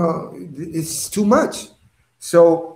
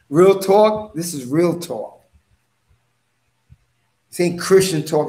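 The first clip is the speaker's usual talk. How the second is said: usual speaking voice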